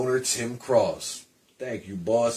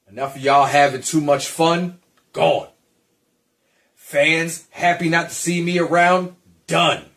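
louder, higher, punchy declarations; loud dismissal; 5 semitones higher, 7 dB louder than usual. Acted. Returning wrestler telling fans he's back, loud and punchy. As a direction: bark each line out at the crowd.

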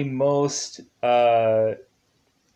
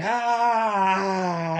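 pained cry; 6 semitones higher than usual. Retold or imitated. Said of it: "he's like".

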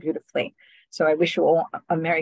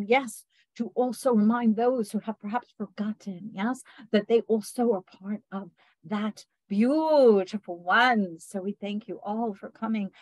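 channeled beings of light; 3 semitones higher than usual. Acted. Healer channels the beings of light to bless listeners, solemn otherworldly voice. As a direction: speak as light beings addressing their beloved ones.

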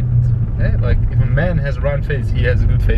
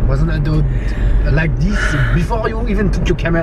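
put-on French accent; 3 semitones higher than usual. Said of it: "speaking in a French accent all morning".

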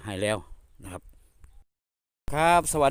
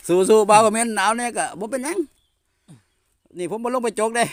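excited cheer; 10 semitones higher, 6 dB louder than usual. Real, not acted. Cheering himself on in cold.

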